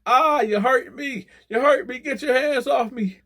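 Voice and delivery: high, slow, loud mock wail; hammed-up old man crying out in pain; old man whines at the guard, high and slow; whining old man.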